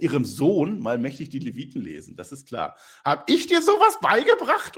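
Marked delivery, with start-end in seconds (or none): 3.0-4.8 much higher, louder scolding, slowed; Vickie's high voice. Retold or imitated. Vickie scolding her son Dominik, shrill high voice. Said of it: "die spricht ja wirklich hoch".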